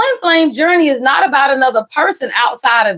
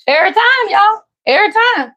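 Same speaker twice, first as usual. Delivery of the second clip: higher, pressing every time; emphatic insistence; 6 semitones higher than usual. Real, not acted. Reader insisting to viewers about family, emphatic and higher. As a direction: hammer home every time.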